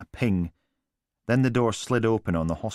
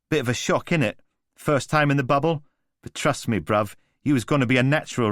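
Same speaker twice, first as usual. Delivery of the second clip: lightly voiced swaggering London geezer; breezy street-slang charm; cocky London lad; 6 semitones higher, 3 dB louder than usual. Alistair winding up his stunned brother, as a lightly voiced cocky London lad.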